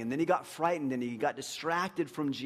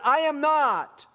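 loud, high, drawn-out denial; acted indignant outburst, slow and high; emphatic denial; 10 semitones higher, 8 dB louder, much slower than usual. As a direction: deny it loudly, stretching each word. Peter's repeated denial, escalated.

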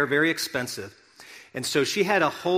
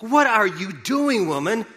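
higher, louder, slow reproach; indignant scolding; 6 semitones higher, 6 dB louder, slower than usual. Retold or imitated. Disciples scolding Mary at the feet of Jesus, indignant. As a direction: scold her like an outraged disciple. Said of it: "And the disciples scold her".

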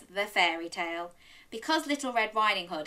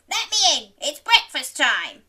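loud, high put-on squeak; squeaky wolf; 6 semitones higher, 8 dB louder than usual. Wolf asking Granny to let him in, squeaky. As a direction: squeak loudly, pitch easing down. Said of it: "squeaked the wolf".

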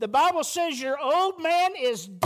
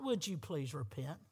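Would you please repent asked much softer and lower with a rising tune; mock polite plea; 10 semitones lower, 13 dB quieter than usual. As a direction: ask it as a meek, polite request, much softer.

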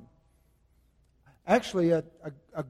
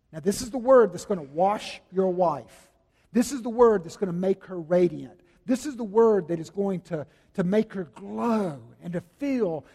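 ordinary talk

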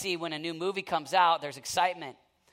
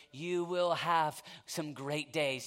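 ordinary talk

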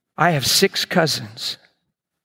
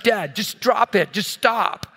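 higher, drawn-out exasperated plea; mock exasperation, pitched up and slowed; fed-up daughter; 6 semitones higher, slower than usual. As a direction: imitate a daughter who is done with it. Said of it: "like, done with it".

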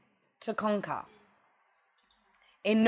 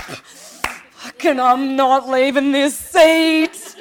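a hint of a parent who will not budge, slow and louder; mock stubborn parent; 3 semitones higher, 6 dB louder, much slower than usual. Mother to her opening-up teenager, mock stubborn and slow. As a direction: dig in, slow and firm, half joking. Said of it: "You're like".

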